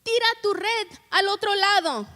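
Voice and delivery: high-pitched call to men at a distance. Acted. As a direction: call the instruction across the water. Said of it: "Él les grita y les dice".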